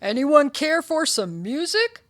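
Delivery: loud, high offer to guests; host calling out. Acted. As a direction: pitch it up over a party crowd. Stanley calls to party guests.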